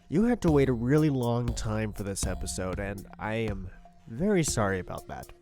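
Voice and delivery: ordinary talk, nothing marked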